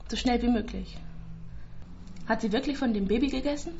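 ordinary delivery

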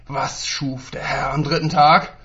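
slow, loud interrogating teacher; drohende Frage. Acted. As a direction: grill the boy, slow and loud. Lehrer verhört Schüler drohend. (Lehrer fragt den Schüler langsam und laut drohend ab)

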